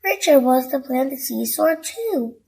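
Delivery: ordinary delivery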